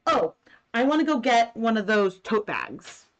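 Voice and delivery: normal talking voice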